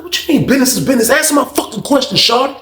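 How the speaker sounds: high, retold confrontation; heated demand. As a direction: imitate an angry man demanding an answer. Roommate demands an answer.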